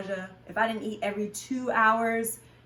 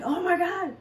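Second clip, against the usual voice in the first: sudden exasperation; 9 semitones higher than usual. Retold or imitated. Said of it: "all of a sudden I'd be like".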